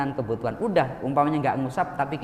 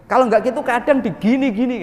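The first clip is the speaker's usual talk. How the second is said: much higher and louder, acting out rough rubbing; animated louder; 8 semitones higher, 8 dB louder than usual. Preacher acts out donors roughing up orphans' heads, loud and high. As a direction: push it up high and loud, half laughing.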